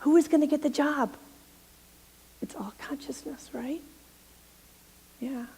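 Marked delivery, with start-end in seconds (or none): 2.4-5.5 hushed whisper. Real, not acted. Minister confiding to the congregation in a whisper. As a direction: breathe it like a secret.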